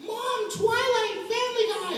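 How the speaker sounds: put-on high childish kid voice; little kid voice. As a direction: squeak it like an excited little kid. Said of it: "it's like".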